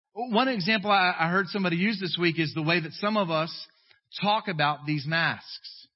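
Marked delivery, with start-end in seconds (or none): none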